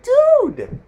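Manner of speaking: loud, very high-pitched yell; indignant shout. Retold or imitated. Shouts back at yelling driver.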